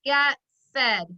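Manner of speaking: words spaced out slowly for copying; slow classroom read-back; slow dictation. Shown as a demonstration. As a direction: read it slowly so they can copy. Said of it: "move it down to the sentence line".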